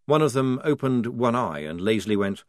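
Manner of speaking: usual speaking voice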